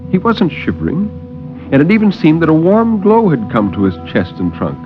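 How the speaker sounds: ordinary talk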